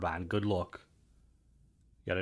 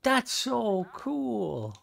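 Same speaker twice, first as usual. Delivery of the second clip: higher and very slow, drawn out in awe; delighted; 12 semitones higher, much slower than usual. Real, not acted. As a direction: savour every word of it. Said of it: "delighted at the mod working".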